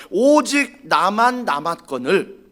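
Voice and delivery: drawn out slowly, stress on 오직; slow emphatic quote. Shown as a demonstration. Preacher quoting Elijah's complaint, slow and weighty. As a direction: stretch 오직 나만 out slowly. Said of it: "오직 나만 남았다라고 말합니다".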